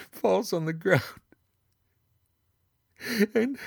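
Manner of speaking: giggling toward hysteria as the head rolls; laughing. Acted.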